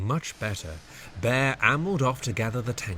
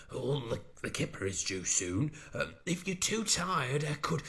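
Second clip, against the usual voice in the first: softer nervous halting words; falter, unsure; stammering; 4 semitones higher, 6 dB quieter than usual. Acted. Bear nervously offering help, soft stammer. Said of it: "stammered Bear".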